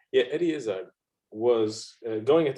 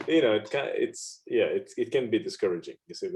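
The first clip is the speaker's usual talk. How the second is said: ordinary talk, nothing marked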